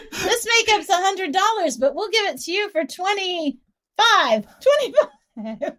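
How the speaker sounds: laughing through a quoted sales pitch; giggling while quoting, pitch up. Retold or imitated.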